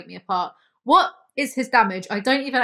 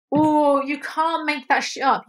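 louder, higher, then slowing right down; disbelieving exclamation; 5 semitones higher, 3 dB louder, slower than usual. Real, not acted. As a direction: marvel at the irony, drawn out. Disbelieving at the irony.

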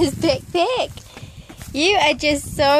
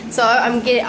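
ordinary talk

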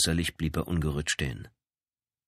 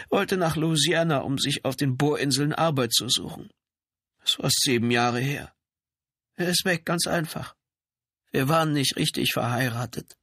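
high, pitched-up woman's character voice; frightened trailer woman; 8 semitones higher than usual. Acted. Woman defends her children to the policeman, high-voiced.

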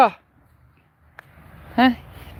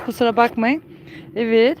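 ordinary talk, nothing marked

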